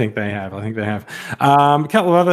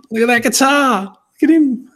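excited; 9 semitones higher, 5 dB louder than usual. Real, not acted. Showing off toy excitedly.

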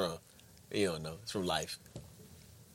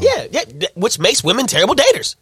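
loud, high insistence; emphatic; 6 semitones higher, 9 dB louder than usual. Real, not acted. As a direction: drive the point home. Emphatic argument.

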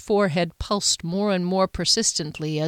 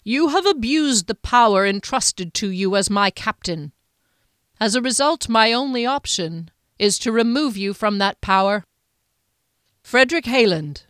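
a measured, stern lord's voice, a little higher; stern Duke, condemning; 4 semitones higher, 4 dB louder than usual. Acted. The Duke to his captain, grave condemnation.

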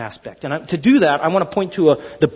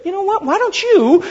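high-pitched, exasperated retort; mock-indignant comeback in a raised pitch; upset fisherman; 10 semitones higher than usual. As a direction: throw it back, irritated and high. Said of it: "he's probably upset".

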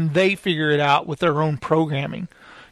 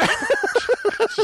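laughing. Real, not acted. Breaks up mid-voice.

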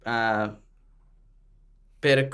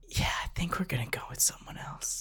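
whisper; 4 semitones higher, 10 dB quieter than usual. Pretend. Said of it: "aside rejecting actor".

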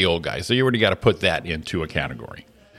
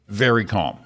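usual speaking voice

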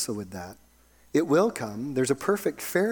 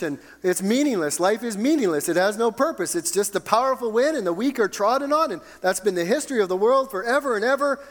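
high-pitched, driving lament from the pulpit; impassioned preaching; 6 semitones higher, 4 dB louder than usual. Acted. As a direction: build the bleak case, pitch climbing. Preaches with rising intensity.

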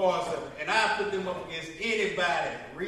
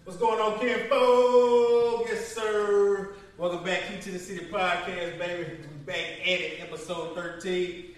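hype podcast intro; 3 semitones higher, 6 dB louder, slower than usual. Acted. Podcast opening greeting.

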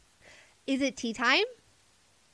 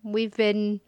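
usual speaking voice

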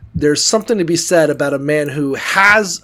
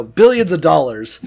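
normal talking voice

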